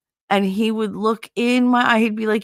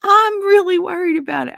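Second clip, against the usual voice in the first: put-on higher, louder voice of a worried teen son; 12 semitones higher, 5 dB louder than usual. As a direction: imitate the son fretting. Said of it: "He was like".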